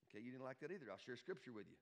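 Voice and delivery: normal talking voice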